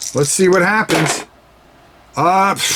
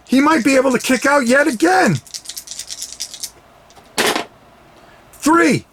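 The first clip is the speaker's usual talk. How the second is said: rising excitement; 7 semitones higher than usual. Acted. Commentator builds near kick-out.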